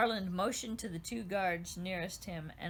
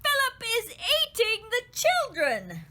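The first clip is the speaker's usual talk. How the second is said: high, loud alarmed cry; horrified outburst at the screams; 14 semitones higher, 10 dB louder than usual. A narrator's reading. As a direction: shriek it in shock. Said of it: "Tristan cried".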